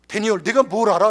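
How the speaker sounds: put-on loud, incredulous retort to a child; retold parental rebuke; father's scolding challenge. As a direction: challenge him with emphatic force. Father challenges his small son.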